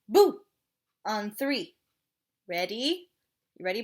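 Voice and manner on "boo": loud playful boo; kids show host. Shown as a demonstration. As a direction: show the kids how to shout it. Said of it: "You're going to say".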